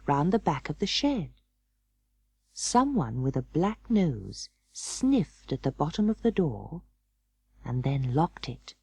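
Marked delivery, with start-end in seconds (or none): none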